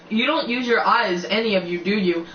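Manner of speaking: ordinary delivery, nothing marked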